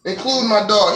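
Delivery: ordinary delivery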